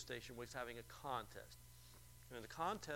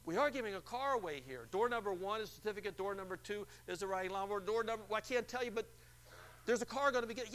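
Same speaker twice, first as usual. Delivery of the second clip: loud, higher game-show announcer voice; announcer teasing the car prize to the caller; radio announcer; 7 semitones higher, 7 dB louder than usual. Retold or imitated. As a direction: hype the hidden car.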